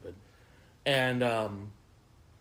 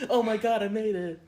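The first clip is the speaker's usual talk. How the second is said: higher, louder giddy relief; relieved laughter; 9 semitones higher, 4 dB louder than usual. Pretend. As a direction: gush like you just made it home. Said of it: "She got her life together".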